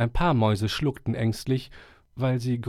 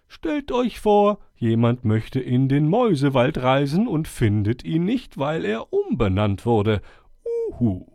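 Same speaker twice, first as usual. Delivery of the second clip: put-on higher, solemn old owl voice; 7 semitones higher, 3 dB louder than usual. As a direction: speak like a wise old owl addressing the forest. Owl speaking again.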